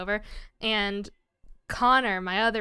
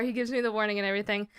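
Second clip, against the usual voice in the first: usual speaking voice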